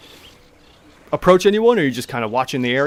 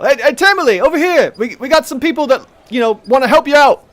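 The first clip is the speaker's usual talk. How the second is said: much higher and louder, calling across the hall; elf calling out; 13 semitones higher, 9 dB louder than usual. Acted. Elf calling Tamili over across the noisy hall, loud call. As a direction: shout over the crowd to get her attention. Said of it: "the elf cries out".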